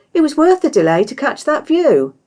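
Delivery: high, loud cheerful protest; bright and insistent, pushing the point; cheery chatter. A narrator's reading. Cheery arguing with Surly on arrival, cheerful.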